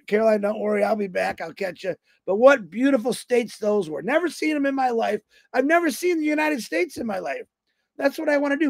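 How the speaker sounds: ordinary talk, nothing marked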